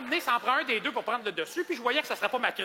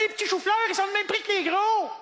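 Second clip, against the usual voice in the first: high, loud complaining voice; whiny lady; 11 semitones higher, 4 dB louder than usual. Retold or imitated. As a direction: whine it like a fussy shopper. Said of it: "Elle dit". (cliente difficile qui se plaint d'une voix aiguë)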